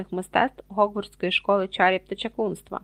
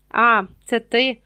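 slow, louder greeting full of distaste; disgusted; 2 semitones higher, 6 dB louder, slower than usual. A narrator's reading. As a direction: draw it out with disgust. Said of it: "так, ніби вступив черевиком у щось гидке".

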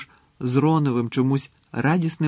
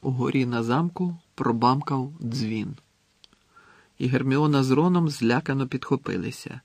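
usual speaking voice

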